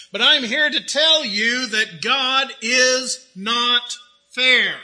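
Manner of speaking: slow build, FAIR hit loud; emphatic claim. Acted. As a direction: lean hard into "fair.". Preacher's key claim, slowed, punched.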